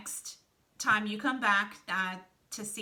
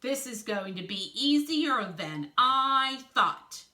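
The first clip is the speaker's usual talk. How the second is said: slow, loud, higher-pitched confident character voice; confident Wolf figure; 5 semitones higher, 9 dB louder, slower than usual. A narrator's reading. Reader voices Wolf finding the heist easier than expected, confident. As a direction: draw it out loudly, pleased with progress.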